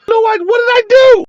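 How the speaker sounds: high, loud exasperated protest; fired up. Real, not acted. Creator protesting to followers, fired up and exasperated. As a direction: plead your case loudly, high-pitched. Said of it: "Anyway, I'm fired up".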